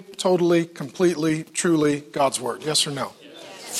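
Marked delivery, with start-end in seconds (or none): 3.2-3.8 whispered yes. Real, not acted. Emphatic answer whispered.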